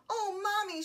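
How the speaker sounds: put-on high-pitched child's plea; excited little girl. A narrator's reading. Little girl spots Corduroy, calling Mommy excitedly. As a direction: lift it high like an eager child.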